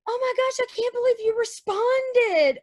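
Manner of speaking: high, gushing disbelief, slowing at the end; imitated starstruck fan. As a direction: mimic an amazed fan in a high voice. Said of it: "somebody goes".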